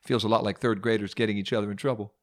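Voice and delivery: ordinary talk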